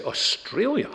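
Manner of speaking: high, sharply rising question; astonished retold question, pitch leaping up; incredulous echo. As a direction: repeat it back in disbelief. Father echoes answer in disbelief.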